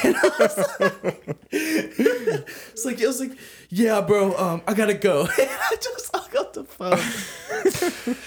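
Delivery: laughing high through the words. Real, not acted. Telling a friend how he hung up on his brother, laughing high. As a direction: break into laughter while telling it.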